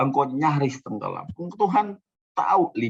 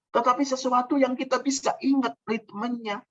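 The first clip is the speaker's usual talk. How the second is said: ordinary talk, nothing marked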